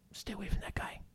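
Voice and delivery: hushed, unvoiced gossiping aside; whisper. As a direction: whisper it as if warning a friend behind his back. Imagined gossip about an angry man.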